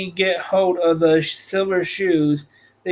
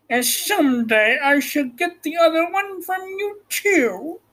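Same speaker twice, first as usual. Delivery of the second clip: taunting witch; 11 semitones higher than usual. Acted.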